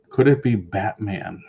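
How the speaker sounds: ordinary talk, nothing marked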